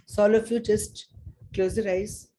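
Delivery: calm guiding voice, easing them in; guided meditation. Acted. Leads a meditation.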